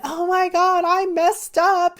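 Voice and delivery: high, slow mock crying cry; half-laughing retelling of a teary outburst; retold tearful wail. Retelling breaking into tears.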